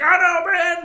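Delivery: agitated agreement. Retold or imitated. Customer agreeing.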